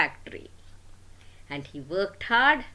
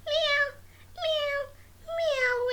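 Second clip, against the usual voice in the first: very high squeaky meows; kitten meow; slower than usual. Acted. Storyteller imitating the cute kitty, high mewing. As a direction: mew like a tiny kitten.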